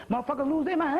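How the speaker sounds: ordinary talk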